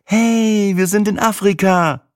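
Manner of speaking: high, bright burst of joy; beaming with excitement. A narrator's reading. Sina arriving in Africa, beaming, high excited call. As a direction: squeal it like a happy squirrel. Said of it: "strahlte Sina wie ein Eichhörnchen".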